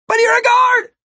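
very high, loud protest; incredulous shout. A narrator's reading. Martin protests to Ampix as he runs past, incredulous shout. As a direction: shout it in disbelief while running. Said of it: "Martin shouted as he ran past".

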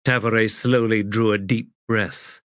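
ordinary delivery

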